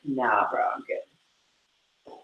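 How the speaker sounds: a chilled-out horse brushing it off; drowsy dude voice for the pony; laid-back horse. Retold or imitated. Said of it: "He's like".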